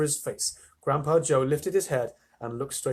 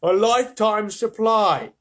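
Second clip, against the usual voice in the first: loud, high, drawn-out call; envious exclamation; 7 semitones higher, 8 dB louder, slower than usual. A narrator's reading. Onlooker marvels at the prize, loud and envious. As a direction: marvel loudly, stretching the words. Envious crowd member calls out.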